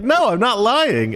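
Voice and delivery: high, drawn-out retold protest; incredulous retort. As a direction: retell it slow and high, amused disbelief. Retelling his incredulous answer.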